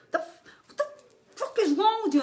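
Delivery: retold screaming rage. Psychic voicing the woman kicking him, screaming rage.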